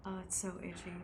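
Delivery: ordinary talk, nothing marked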